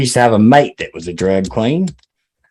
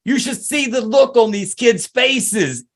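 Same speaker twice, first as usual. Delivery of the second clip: outraged Italian guy, pitched higher, ranting at what he sees; Italian guy impression; 5 semitones higher than usual. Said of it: "impersonation of an Italian guy".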